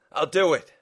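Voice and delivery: urgent, high, loud surrender; yelled. Acted. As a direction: shout it out, giving in. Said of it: "Mark yelled".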